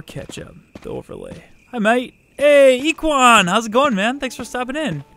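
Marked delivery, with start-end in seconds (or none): 1.7-5.0 excited greeting. Real, not acted. Greeting a new viewer excitedly.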